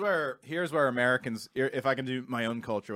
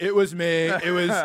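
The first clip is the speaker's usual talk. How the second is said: louder, higher boastful politician; Trump; 5 semitones higher, 6 dB louder, slower than usual. Acted. Comic quoting his old bit, boastful president impression. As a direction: brag it out, loud and self-important. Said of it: "it would be like Trump would say".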